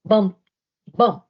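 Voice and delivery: loud punchy bump bump; bumping sound effect. A narrator's reading. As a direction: thump it out like a heavy bump.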